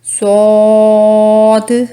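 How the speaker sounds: सौद held long, loud; long saad. Shown as a demonstration. Teacher models a long, loud सौद. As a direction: stretch सौद out fully. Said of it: "इसमें भी तीन हरूफ हैं".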